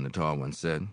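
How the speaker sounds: ordinary delivery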